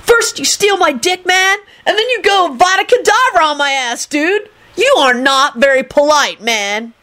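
high, aggrieved shrieking; indignant high-pitched character voice; angry shriek. A narrator's reading. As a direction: shriek your complaint up high, easing down at the end. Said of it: "Kenny shrieked angrily".

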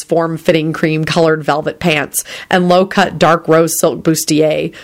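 ordinary delivery, nothing marked

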